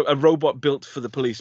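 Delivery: normal talking voice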